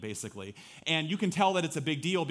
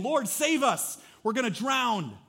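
much higher, louder mock panic; panicked disciples; 9 semitones higher, 5 dB louder than usual. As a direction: cry out like terrified men in a storm.

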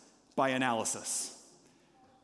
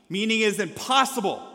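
ordinary talk

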